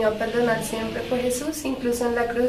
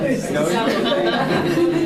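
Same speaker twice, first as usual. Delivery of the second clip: risa breve; 6 dB louder than usual. Real, not acted. Listener laughs at reply.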